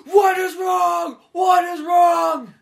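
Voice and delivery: very high, loud, drawn-out mock wail; meltdown scream. Co-host imagines friend breaking down at Safeway, hammed-up screaming. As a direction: scream each word out in hammed-up despair. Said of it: "breaking down on the ground".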